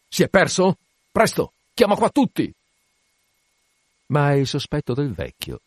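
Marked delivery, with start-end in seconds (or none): 0.1-2.5 high, urgent questioning and order; rising captain's alarm; alarmed urgency. Acted. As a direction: summon everyone in sudden alarm. Captain alarmed, man is missing.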